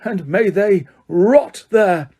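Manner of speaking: roar, then drag out the curse; furious roar. A narrator's reading. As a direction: Crouch roaring at the Dementors. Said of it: "Crouch roared".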